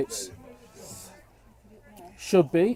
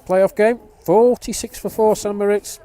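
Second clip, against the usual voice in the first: louder, higher play-by-play lift; excited; 7 semitones higher, 9 dB louder than usual. Real, not acted. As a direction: build the score up with energy. Commentator builds excitement on score.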